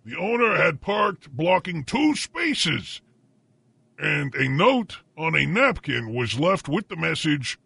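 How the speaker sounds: slow, emphatic newsreader with stretched place names; drawn-out dateline announcer delivery; news read. Acted. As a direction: announce it like a grand breaking bulletin. Reads the breaking news story.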